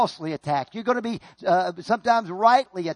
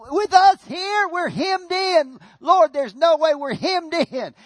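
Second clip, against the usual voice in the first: high, drawn-out wail of complaint; hammed-up panicked lament; acted desperate cry; 8 semitones higher, 3 dB louder, slower than usual. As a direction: cry out to God as if trapped. Acts trapped Israelites crying out to God.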